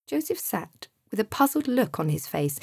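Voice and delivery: plain reading voice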